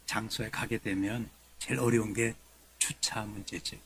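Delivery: usual speaking voice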